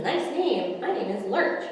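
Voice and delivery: plain reading voice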